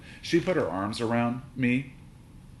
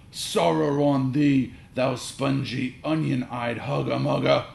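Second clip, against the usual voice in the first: high, loud, slow theatrical insult; Shakespearean cursing dad; 5 semitones higher, 6 dB louder, slower than usual. A narrator's reading. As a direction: declaim the curse like a stage actor. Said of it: "my dad might say".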